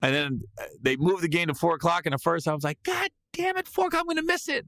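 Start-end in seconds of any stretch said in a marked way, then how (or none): 2.8-4.7 high-pitched retold exasperation; exasperated outburst. Comedian recalling fear of missing the game, high exasperated outburst. Comic retelling of dismay at game time.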